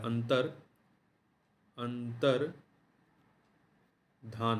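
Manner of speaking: "अंतर", long pause, then "धान" without a y; correct form, broken up. Shown as a demonstration. Teacher spelling it out for students, "अंतर ... धान" in separate parts. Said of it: "धान होता है".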